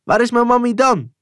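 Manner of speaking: put-on high, loud desperate child's call; child crying out. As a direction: wail it up high like a frightened boy. Kind roept om zijn moeder. (hoog wanhopig roepend kind)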